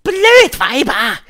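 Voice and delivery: high, loud, drawn-out insult; slow, high witch howl. A narrator's reading. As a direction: howl it in exasperation at the audience. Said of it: "heulte die Hoch".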